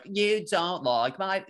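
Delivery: slow nasally whine; nasally emo voice. Shown as a demonstration. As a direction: pinch it through the nose, emo style. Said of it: "if you say it with, like, a nasally voice".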